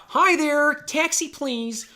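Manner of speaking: high, loud, exaggerated American accent; hammed-up American caller, loud and high-pitched. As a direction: put on an over-the-top American voice, high and drawn out on "please". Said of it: "speaking in a completely American accent".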